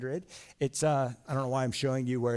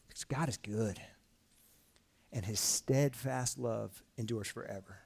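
hushed reverence; 5 semitones lower, 6 dB quieter than usual. Acted. Solemn answer after the hype.

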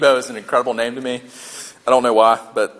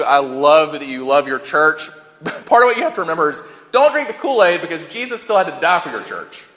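ordinary talk, nothing marked

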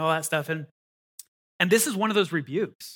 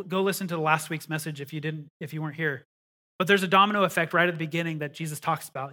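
usual speaking voice

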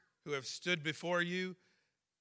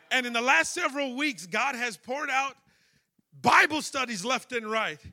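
louder, higher preaching build to a shout; full-force preaching; 9 semitones higher, 9 dB louder than usual. Acted. Pastor calling out Miss Harris from the pulpit, full-force preaching. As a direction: preach it straight at her, rising.

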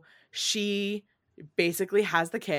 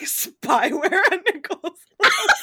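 laughing; 10 semitones higher, 7 dB louder than usual. Real, not acted. Joking, laughing mid-line.

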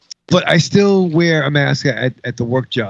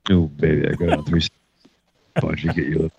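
sexy low voice; 12 semitones lower, 5 dB quieter than usual. Pretend. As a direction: purr it like a bedroom voice. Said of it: "I'm trying to get sexy with him".

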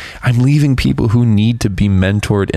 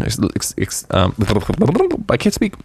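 choked up; 3 dB quieter than usual. Real, not acted. Speaker overcome by a favorite verse, choked up. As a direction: voice catching, struggling to get words out. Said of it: "Sorry, I get so choked up".

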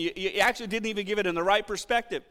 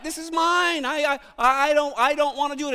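selfish refusal; 5 semitones higher, 3 dB louder than usual. Acted. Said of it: "in our soul we're saying what?".

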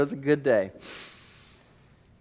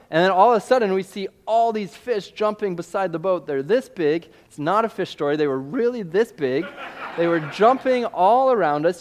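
usual speaking voice

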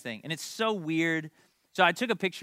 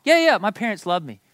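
loud, higher mock brush-off; dismissive kid; 4 semitones higher, 8 dB louder than usual. Sons and daughters brushing off parents' love, loud and dismissive. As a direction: imitate a kid waving it away.

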